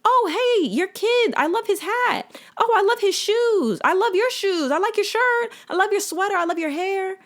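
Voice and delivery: high, gushing, sugary chatter to strangers; high gushing compliments to strangers in the park; bubbly compliments. Pretend. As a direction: gush compliments in a high chirpy voice. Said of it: "Y'all need to say".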